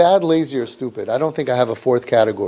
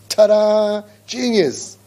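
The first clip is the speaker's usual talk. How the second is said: higher mock triumph; sarcastic ta-da; 6 semitones higher, much slower than usual. Real, not acted. The speaker mocking parents' easy advice with sarcasm. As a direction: announce the obvious with irony.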